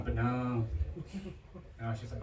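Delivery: normal talking voice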